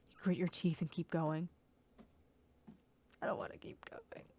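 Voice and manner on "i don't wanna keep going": whispering. Real, not acted. Reluctant whisper to self.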